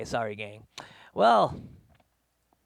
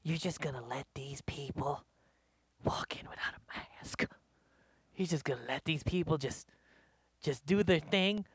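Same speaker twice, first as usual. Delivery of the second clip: imitating a hushed, indignant whisper; mock whispered complaint; 3 semitones lower, 9 dB quieter than usual. Lady complaining to restaurant staff about maskless diners, hushed mock whisper. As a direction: hiss the complaint softly, half-hearted. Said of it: "starts saying things like".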